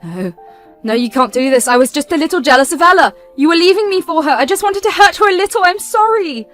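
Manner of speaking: the sister begging her brother to let her come; desperate begging. Acted. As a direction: beg him not to do it.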